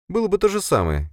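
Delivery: Sofia, composed subordinate. Acted. Sofia excuses her failure to the boss, composed. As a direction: answer back in a high, restrained voice.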